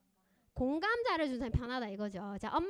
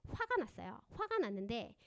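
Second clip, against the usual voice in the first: ordinary delivery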